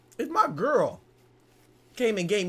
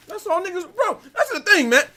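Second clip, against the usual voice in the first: suddenly loud and high, getting worked up; fired up, voice jumping high; hyped up; 10 semitones higher, 6 dB louder than usual. Real, not acted. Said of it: "I just got hyped".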